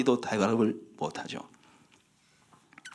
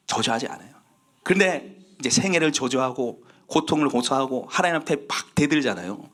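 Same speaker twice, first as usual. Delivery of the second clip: ordinary talk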